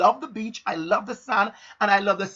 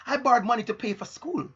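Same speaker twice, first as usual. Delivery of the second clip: ordinary talk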